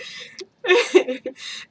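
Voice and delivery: high, loud burst of real laughter; uncontrollable laughter. Real, not acted. Boyfriend's worried remark sets her off, loud real laughing fit. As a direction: crack up and can't stop. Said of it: "And I cannot stop laughing".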